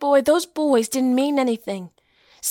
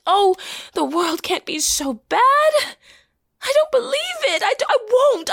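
tearful denial; 7 semitones higher than usual. Acted. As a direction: voice breaking high into tears. Rachel's anguished denial in play.

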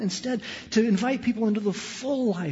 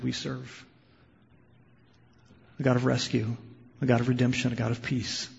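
prayer; 5 semitones lower than usual. Acted. Closing prayer.

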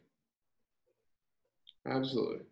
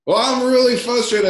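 much higher, louder exasperated complaint; frustrated customer; 14 semitones higher, 8 dB louder than usual. Retold or imitated. Angry caller repeating his gripe to the owner, high and loud. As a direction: push it up into a strained upset whine.

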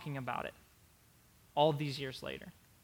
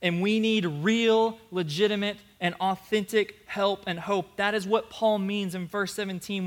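preaching at full force; 4 semitones higher, 7 dB louder than usual. Acted. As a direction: drive the point home to the church.

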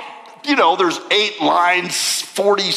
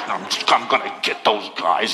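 muttered low angry threat; 11 semitones lower, 4 dB quieter, faster than usual. Retold or imitated. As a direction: grumble it low like a hunter. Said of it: "He's angry".

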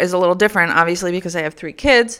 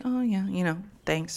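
quiet, offhand thanks, much softer; dismissive mumbled thanks; 11 dB quieter than usual. Retold or imitated. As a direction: imitate someone brushing a gift off under their breath. Aunt brushes off the gift cards.

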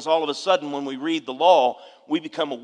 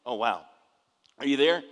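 ordinary talk

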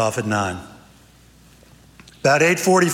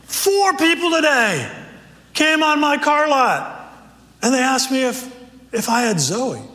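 pumped car salesman; 10 semitones higher than usual. Acted. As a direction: sell the hello like a car dealer. Car salesman tells his day.

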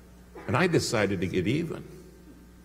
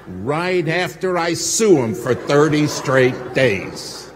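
slow, loud, higher defiant boast; pounding out his vow of revenge; defiant shout; 6 semitones higher, 7 dB louder, slower than usual. Retold or imitated. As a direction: bellow the revenge plan. Boasting about suing.

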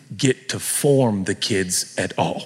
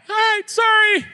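flustered; 4 dB louder than usual. Retold or imitated. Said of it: "You look fine".

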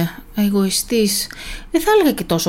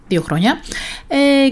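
ordinary talk, nothing marked

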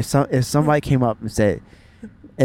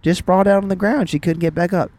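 higher-pitched Southern mom impression; Southern accent; 5 semitones higher than usual. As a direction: report it plainly in a high Southern twang. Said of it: "saying this in a Southern accent".

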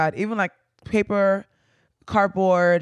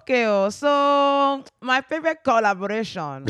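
attempted Nigerian accent; 6 semitones higher than usual. Shown as a demonstration. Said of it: "that's my evil attempt".

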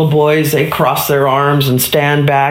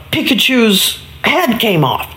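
ordinary delivery, nothing marked

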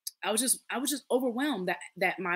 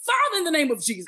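sudden prayer; 8 semitones higher, 7 dB louder than usual. Retold or imitated. Grandmother bursting into sudden prayer.

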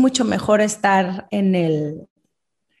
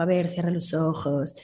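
soft teacher voice; 3 semitones lower, 6 dB quieter than usual. Retold or imitated.

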